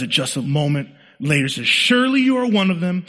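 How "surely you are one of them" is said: high, loud acted accusation; pitched-up voice of a confronting bystander; accuser in crowd. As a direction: call him out in front of everyone. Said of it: "And someone".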